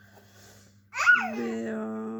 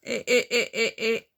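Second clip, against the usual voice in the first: loud warning; 8 dB louder than usual. Real, not acted. Mother stops her toddler from touching something, loud warning. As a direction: call out to stop the little one.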